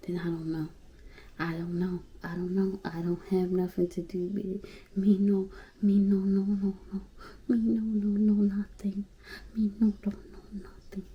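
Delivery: whispered, fading denial; backing away from the drama in a whisper; quiet denial. Real, not acted. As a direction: hush it down to nothing. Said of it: "trailing denial".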